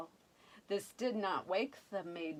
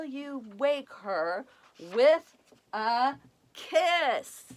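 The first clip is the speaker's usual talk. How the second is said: scolding fairies; 5 semitones higher, 7 dB louder than usual. Acted.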